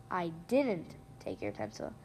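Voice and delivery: "didn't" hit as a firm denial, the line dropping low; stress on DIDN'T. Shown as a demonstration. Host demonstrating stress for listeners, weight on "didn't". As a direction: deny it by punching "didn't". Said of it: "a different stress word each time".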